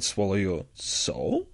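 "Swallow your soul?" slow, with SOUL rising at the end; "Swallow your soul?" drawn out as a query; questioning. Acted. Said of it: "And it was a question".

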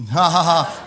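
loud, fake ha-ha-ha; strained mock laugh for the kid's sake; forced laughter. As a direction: push out a laugh that's run out of fun. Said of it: "You're trying to keep laughing".

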